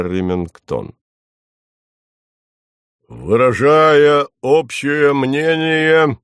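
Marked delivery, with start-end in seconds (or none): 3.1-6.2 pompous chairman. Acted.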